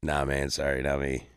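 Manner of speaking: low, quiet, casual denial; playing coy. Dodging a beer rep who recognizes him, low and coy. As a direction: shrug off the question like it's nothing. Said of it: "I did the same thing again".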